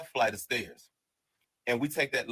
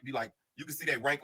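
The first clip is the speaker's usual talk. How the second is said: normal talking voice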